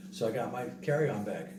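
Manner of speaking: normal talking voice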